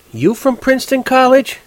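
very high woman's question; intrigued, pitch leaping up; surprised interest. A narrator's reading. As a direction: perk up at the college name. Woman's interest suddenly aroused by Princeton.

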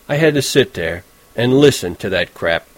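plain reading voice

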